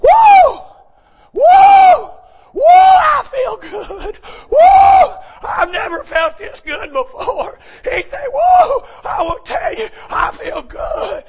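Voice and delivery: very high, loud, slow joyful whooping; ecstatic whoops. Retold or imitated. Saved man shouts his joy after praying in the park. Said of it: "Got up and he said".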